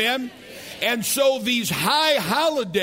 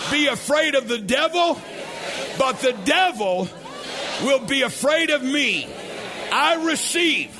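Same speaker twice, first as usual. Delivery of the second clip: call and response declaration; 4 semitones higher than usual. Shown as a demonstration. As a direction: lead the crowd in a shouted confession. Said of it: "Say this out loud".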